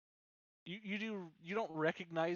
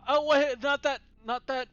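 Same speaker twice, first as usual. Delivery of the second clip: loud, high panicked stop; 11 semitones higher, 15 dB louder than usual. Real, not acted. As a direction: urgently call out to stop them. GM panics as player runs.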